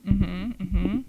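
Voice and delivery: normal talking voice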